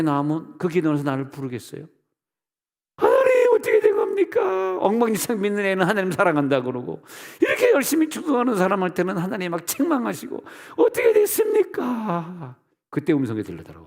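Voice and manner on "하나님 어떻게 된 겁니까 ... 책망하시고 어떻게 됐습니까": loud, high wail of protest to God; shouted, hammed-up lament; anguished outcry. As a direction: cry out in acted despair, stretching the endings. Acts out outraged cry to God.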